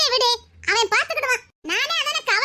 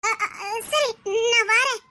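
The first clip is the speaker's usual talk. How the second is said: shaken stammering. Acted. Meena hears shocking news on the phone, shaken stammering. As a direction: stumble over the words in shock.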